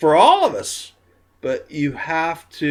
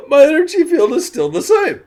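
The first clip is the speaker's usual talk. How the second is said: laughing; 13 semitones higher, 7 dB louder, faster than usual. Real, not acted. Laughing at herself, tipsy.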